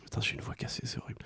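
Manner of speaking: annoyed near-whisper aside; whispered grumble. Real, not acted. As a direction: mutter it under your breath, annoyed. Grumbling about own broken voice.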